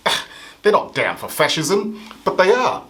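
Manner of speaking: ordinary delivery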